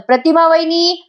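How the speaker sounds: calls out for her sister-in-law to come; calling out. Retold or imitated. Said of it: "मोठ्याने ओरडते सुमन". (वहिनीला उंच आवाजात हाक मारणं)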